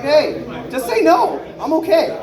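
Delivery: slow, high plea at the door; mock begging, drawn out and high; pleading. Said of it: "knock on the door like".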